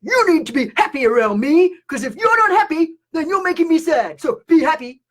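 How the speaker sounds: mock demanding empath. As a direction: imitate a needy scolding empath. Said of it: "an empath has come up to you and say".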